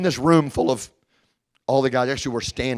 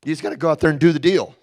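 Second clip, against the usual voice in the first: cavalier; 3 semitones higher, 6 dB louder than usual. Retold or imitated. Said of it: "John is pretty cavalier about it".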